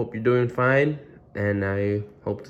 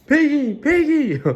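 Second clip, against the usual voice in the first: loud, high, joyful shout; excited calling; 6 dB louder than usual. Acted. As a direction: call a friend with great news. Gerald calls Piggy with news.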